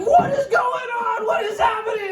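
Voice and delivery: hammed-up panic like a red alert; panicked dad. Anxious dad over a fallen baby, mock panic. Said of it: "fucking red alert".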